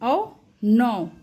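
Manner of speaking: औ and नौ said very slowly, औ held; teacher stretching औ, नौ; drawn-out demo. Shown as a demonstration. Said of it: "देखिए लौ".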